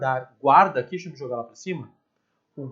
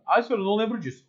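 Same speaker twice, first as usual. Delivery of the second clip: lightly voiced higher-pitched pupil; a hint of a complaining pupil; protesting student; 7 semitones higher, much faster than usual. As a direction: playfully mimic a student owning up to the teacher. Teacher voices a forgetful student protesting.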